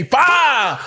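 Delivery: high shouted "Fire!"; excited shout. Real, not acted. Streamer hyped watching Bitcoin crash, shouting. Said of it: "I'm just excited".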